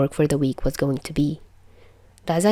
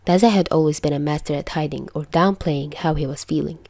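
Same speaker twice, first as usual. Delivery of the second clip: usual speaking voice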